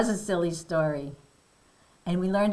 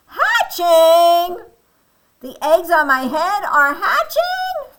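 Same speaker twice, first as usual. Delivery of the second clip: high, very loud disbelieving cry; shocked; 10 semitones higher, 11 dB louder, slower than usual. Acted. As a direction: shriek it as a horrified storybook Elephant. Elephant shocked eggs are hatching.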